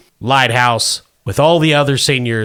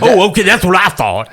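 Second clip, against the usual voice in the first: put-on old prospector; 6 semitones higher, 3 dB louder than usual. Wilbur voiced by the GM.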